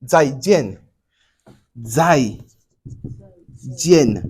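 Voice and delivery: Zai jian spoken with careful Chinese tones; 再见 发音示范; Chinese tones shown. Shown as a demonstration. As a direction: say Zai, then jian, apart and exact. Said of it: "C'est jian ou bien c'est jian".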